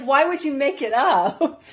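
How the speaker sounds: slow, loud quote through laughter; laughing mock question. Imitating the puzzled stranger at Starbucks, laughing. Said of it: "'cause she's like".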